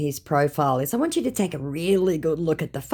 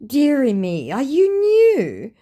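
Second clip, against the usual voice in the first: high, slow patronising dearie me; mock-pitying teasing; condescending; 10 semitones higher, 6 dB louder, slower than usual. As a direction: talk down to him sweetly. Condescending aside to scammer.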